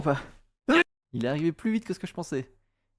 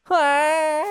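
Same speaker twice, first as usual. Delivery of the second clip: excited yelp; 11 semitones higher, 7 dB louder than usual. Real, not acted. Celebrating a win.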